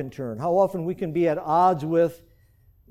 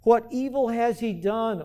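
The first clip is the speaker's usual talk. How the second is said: Pilate's plea; 5 semitones higher, slower than usual. Acted. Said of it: "Then Pilate said to them".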